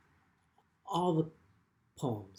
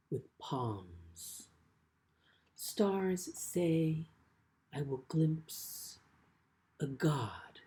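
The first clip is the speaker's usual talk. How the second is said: measured, deliberate poem reading with long pauses; poetry reading; slower than usual. Acted. Poet reads a poem about his father to the audience, measured and unhurried. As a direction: let each image land slowly, word by word.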